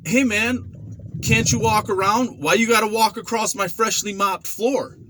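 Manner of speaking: mock the mopper's indignant whine; annoyed inmate. Inmate griping about his freshly mopped floor, high whine. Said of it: "he was like".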